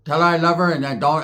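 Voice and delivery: ordinary delivery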